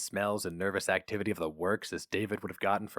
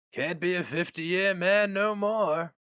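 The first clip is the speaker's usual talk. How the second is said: put-on slow, pitying old man; 9 semitones higher, slower than usual. A narrator's reading. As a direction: feel sorry for the youngster. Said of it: "said the old man pityingly".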